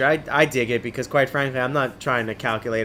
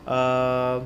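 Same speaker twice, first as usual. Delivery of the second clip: plain reading voice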